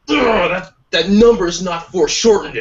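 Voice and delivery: high, loud disgusted grumble; disgusted critic. Retold or imitated. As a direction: scoff at the drawing. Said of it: "I'm just like".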